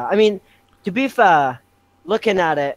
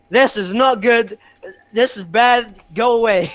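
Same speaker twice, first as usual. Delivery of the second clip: raised, mildly panicked protest; retold panic; 5 semitones higher, 3 dB louder than usual. Fan retelling the cinema moment, mock panic. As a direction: push it away in a higher voice.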